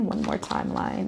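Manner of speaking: breathy self-reminder, barely voiced; whispered aside. Real, not acted. Reader quietly reminding herself to pull another card, whispering. Aside while drawing cards.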